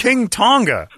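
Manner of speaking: high, disbelieving echo; incredulous question. Real, not acted. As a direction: repeat it as if you can't believe it. Incredulous echo of strange name.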